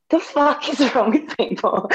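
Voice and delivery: high-pitched incredulous outburst through a laugh; laughing exasperation. Retold or imitated. Patient recounting the doctor walking off, laughing exasperation. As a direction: retell disbelief, breaking into laughter.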